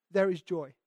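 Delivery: normal talking voice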